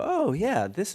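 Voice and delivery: high, bright "Oh, yeah"; mock younger self thrilled by Britpop; excited realisation. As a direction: jump up in pitch with sudden delight. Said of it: "made me go".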